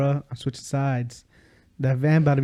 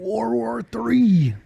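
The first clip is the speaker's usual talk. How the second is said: dramatic announcement; 10 semitones higher, much slower than usual. Pretend.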